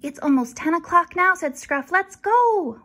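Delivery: high, loud eager puppy. Acted. Scruff urging Jasper to go, high and loud. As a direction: bounce with excitement to go.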